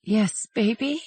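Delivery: high, soft, drowsy mother; just woken, mumbling to her child; sleepy, gentle mom voice. A narrator's reading. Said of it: "she said in a sleepy voice".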